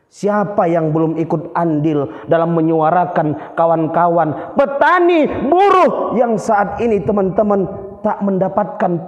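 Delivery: slow, emphatic rally orator, easing off toward the end; orator demonstrasi. Shown as a demonstration. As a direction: rouse the comrades with drawn-out, articulated phrases. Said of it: "Itu pentingnya intonasi, artikulasi". (orasi lantang membakar semangat)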